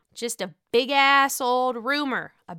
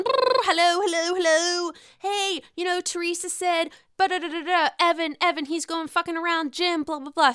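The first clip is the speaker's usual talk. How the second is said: a hint of a gossipy phone call, higher; higher, chattering gossip on the line; gossip phone chain; 7 semitones higher than usual. Vlogger acting out the party gossip phone chain, higher and chattery.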